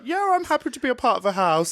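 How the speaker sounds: high, quick breezy impression; imitated nonchalance. Imitating the laid-back house member, breezy nonchalance. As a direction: toss it off, airy and fast. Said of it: "very nonchalant".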